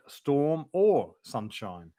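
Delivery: ordinary talk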